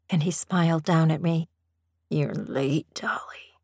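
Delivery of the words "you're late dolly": low-set, understated rough male character. A narrator's reading. Master catching her late in the garden, mildly rough. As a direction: say it a little roughly. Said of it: "he said roughly".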